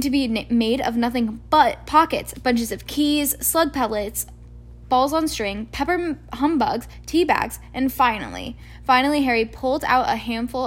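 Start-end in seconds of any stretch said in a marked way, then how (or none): none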